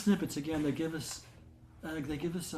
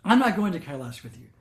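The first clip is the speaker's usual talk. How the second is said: louder angry declaration; retold angry refusal; 3 semitones higher, 9 dB louder than usual. Bholenath refusing the pilgrimage in front of everyone, angry loud refusal. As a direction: throw the refusal at them in a temper. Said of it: "He was just so angry".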